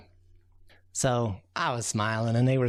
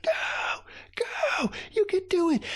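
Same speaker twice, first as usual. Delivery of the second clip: very high excited cheer; root for them all out; slower than usual. Acted. Raccoon cheering the ants down the hill, thrilled.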